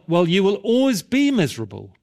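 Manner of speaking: high pulpit warning, settling at the promise; imitated preacher pressing for a decision; exhorting preacher. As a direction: warn emphatically, then ease into assurance. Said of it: "And he went on".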